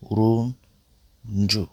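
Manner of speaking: turuncu drawn out slowly syllable by syllable. Shown as a demonstration. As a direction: stretch out turuncu slowly for learners.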